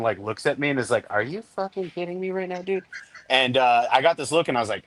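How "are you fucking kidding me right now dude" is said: hushed, high-pitched mock incredulity; softer, higher, can't-believe-you voice; retold disbelief. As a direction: imitate a buddy's stunned, under-the-breath rebuke. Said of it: "the look that G gave me".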